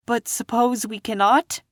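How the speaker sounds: put-on high worried girl's question; doubtful Dorothy. A narrator's reading. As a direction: raise the doubt like a small girl. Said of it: "said the girl".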